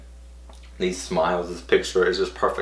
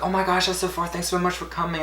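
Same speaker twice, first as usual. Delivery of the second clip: much higher, soft voice; imitated sweet, grateful star; gushing thanks; 10 semitones higher, 4 dB quieter than usual. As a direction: gush sweetly up high.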